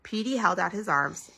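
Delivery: ordinary delivery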